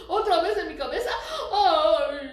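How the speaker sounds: fast, loud, laughing cry; laughing mock alarm. Teacher laughing as the spider lands on her head again.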